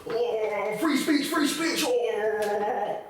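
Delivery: high, loud, mocking gush; shrill parody of free-speech fans; mocking the right. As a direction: squeal it like a gushing fanboy. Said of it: "they're all saying".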